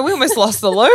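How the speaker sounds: high, loud, laughing. Real, not acted. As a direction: laugh out the line. Laughing after friend nearly fainted.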